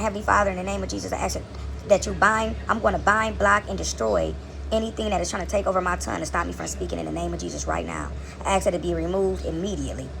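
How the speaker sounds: hushed, rapid prayer binding the tongue; quiet prayer. Real, not acted. Speaker pauses her story to pray, softly. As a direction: pray under your breath, in earnest. Prays aloud mid-story.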